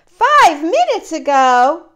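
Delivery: loud, disbelieving question; astonished. Acted. Gerald can't believe what Piggy says, loud astonished question. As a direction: blurt it out in shock.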